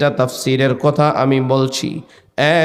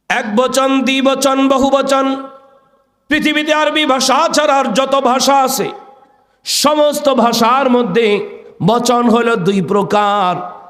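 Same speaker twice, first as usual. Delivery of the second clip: high sermon chant on a held note; preacher's intoned high call; impassioned preaching; 11 semitones higher than usual. Acted. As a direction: proclaim the lesson high and ringing.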